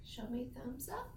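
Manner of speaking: soft whispered cue; whispering. Real, not acted. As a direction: whisper it to the class. Teacher quietly cues the class.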